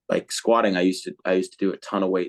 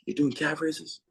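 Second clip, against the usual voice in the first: mocking coach; 8 semitones higher, 6 dB quieter than usual. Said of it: "used to make fun of me".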